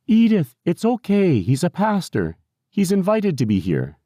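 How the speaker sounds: high, called-out caregiver voice; reassuring caregiver. A narrator's reading. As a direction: call down the hall to settle a resident.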